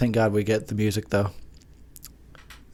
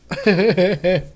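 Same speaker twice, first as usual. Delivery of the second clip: laugh; 7 semitones higher, 3 dB louder than usual. Real, not acted.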